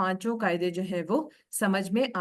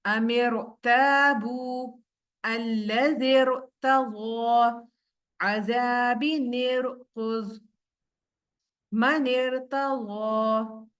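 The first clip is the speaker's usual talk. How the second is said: slow, drawn-out tajweed recitation; Quran recitation; much slower than usual. Shown as a demonstration. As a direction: recite each syllable slowly with heavy r. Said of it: "पीछे पीछे practice कीजिए". (धीमी तिलावत)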